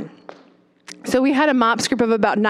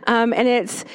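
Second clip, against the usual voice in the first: ordinary talk, nothing marked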